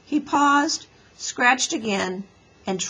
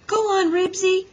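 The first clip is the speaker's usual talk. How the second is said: high, loud urging cry; excited mild scream; 11 semitones higher, 5 dB louder than usual. A narrator's reading. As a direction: urge the dog on at high pitch. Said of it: "screamed Beezus".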